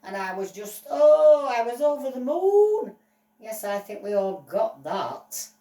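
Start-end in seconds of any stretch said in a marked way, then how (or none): 0.9-3.0 over the moon. Acted. Dog gushing about the show.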